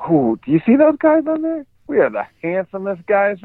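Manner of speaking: high-pitched imitation of an admiring kid; excited boy imitation. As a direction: put on a high, gushing little-boy voice. Retelling foster son admiring selfie.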